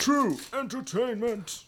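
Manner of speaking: high, soft, drawn-out echo; jingle echo. Pretend. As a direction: echo the title in a slow falsetto. Echoing the segment jingle.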